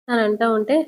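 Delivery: plain reading voice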